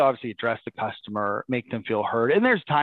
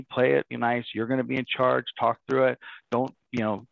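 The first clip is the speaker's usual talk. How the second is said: usual speaking voice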